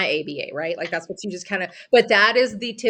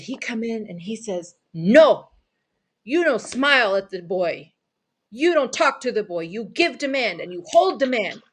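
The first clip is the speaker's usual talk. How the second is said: louder, higher, commanding mock Italian accent; trashed Italian accent; 5 semitones higher, 4 dB louder than usual. Neighbor quoting the therapist's orders, emphatic bad Italian accent. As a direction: issue stern orders in a badly faked Italian accent. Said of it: "trash an Italian accent".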